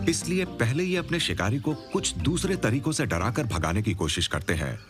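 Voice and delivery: usual speaking voice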